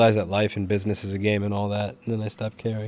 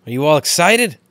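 hyped up; 6 semitones higher, 7 dB louder than usual. Acted. Hyping up stream viewers.

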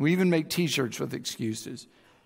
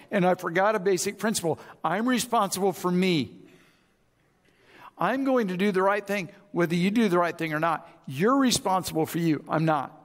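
ordinary talk, nothing marked

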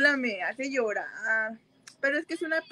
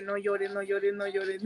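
soft self-talk; 3 semitones lower, 6 dB quieter than usual. Retold or imitated. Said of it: "yo a mí misma estoy de que".

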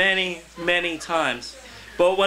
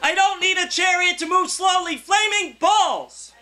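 laughing shout; 12 semitones higher, slower than usual. Acted. Poet shouting the line to the crowd, laughing. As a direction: belt out the punchline, with "flaming balls" stretched slow.

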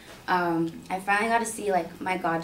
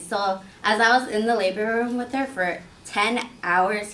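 plain reading voice

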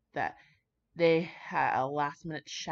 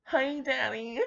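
mock Sherlock; 7 semitones higher, much slower than usual. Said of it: "he's like".

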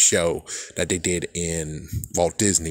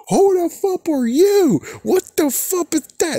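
mock outraged shriek; 5 dB louder than usual. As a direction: shriek at a Giratina you never knew existed. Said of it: "It's like".

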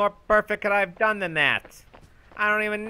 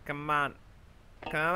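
ordinary delivery

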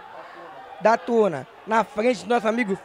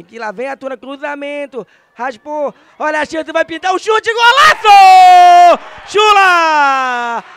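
goal scream; 7 semitones higher, 13 dB louder, slower than usual. Real, not acted.

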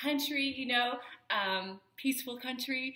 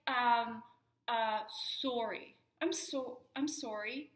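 ordinary delivery

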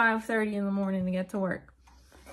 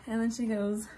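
ordinary delivery

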